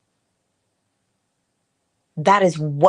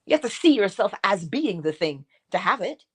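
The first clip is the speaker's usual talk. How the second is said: ordinary talk, nothing marked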